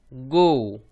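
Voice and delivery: गो as hard g plus long rounded o; blended syllable demo. Shown as a demonstration. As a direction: model गो for learners. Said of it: "तो बन गया".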